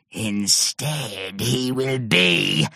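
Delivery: low, slow menacing lead-in; low sinister monster. A narrator's reading. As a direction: hold it back, low and slow. Monster building to the threat.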